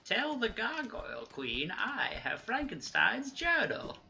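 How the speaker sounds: slow, high, loud hammed-up villain line; bad acting. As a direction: ham up the line like a B-movie. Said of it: "whoever delivers the line delivers it like that".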